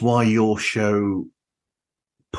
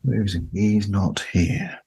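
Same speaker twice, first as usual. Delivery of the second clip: playful whisper. Pretend. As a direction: whisper it like he might overhear. Joking about Darren's absence.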